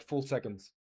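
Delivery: ordinary talk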